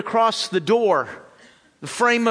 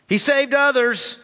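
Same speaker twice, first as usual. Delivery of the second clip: higher sneering remark; scoffing; 6 semitones higher than usual. A narrator's reading. Priests scoff at Jesus, higher sneering voice. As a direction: scoff at his claims. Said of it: "they scoffed".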